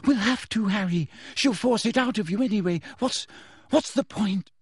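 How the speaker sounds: put-on high, despairing girl's voice; near tears. A narrator's reading. Girl tells friend they must confess, tearful. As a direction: give up, high and faltering. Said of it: "began to cry weakly".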